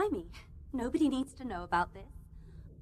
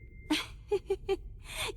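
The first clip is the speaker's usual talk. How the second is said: bitter laugh; 6 semitones higher, 6 dB quieter than usual. Acted. Tied-up woman mocks her captor.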